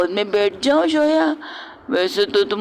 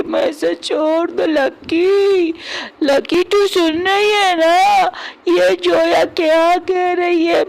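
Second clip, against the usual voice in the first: put-on drunken whining complaint; 6 semitones higher than usual. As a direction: complain to Lucky like a hurt drunk. Drunk man complaining to Lucky.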